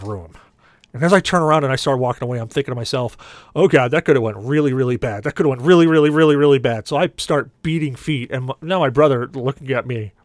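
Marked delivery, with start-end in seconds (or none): 3.5-6.9 louder, panicky repeated worry; comic inner panic; retold alarm. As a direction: build the alarm on each "really". Alarmed thought after seeing thirty opponents.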